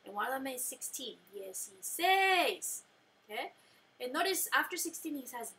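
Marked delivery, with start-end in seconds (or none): 1.3-2.8 dieciséis with the last syllable -SÉIS stressed and drawn out; stress on the accent. Shown as a demonstration. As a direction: lean on the accented end of dieciséis, slowly. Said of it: "So notice dieciséis has an accent".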